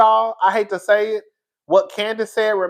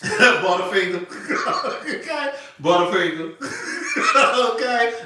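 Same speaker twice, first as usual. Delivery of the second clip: laughing. Real, not acted. Laughing through candy names.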